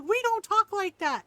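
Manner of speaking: high, loud mock reprimand; imitating scolding mom. Mom correcting her kid, imitated scolding. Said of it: "She's like".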